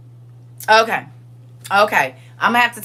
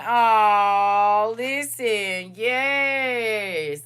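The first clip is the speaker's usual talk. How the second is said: crying; much slower than usual. Real, not acted. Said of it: "I'm already crying".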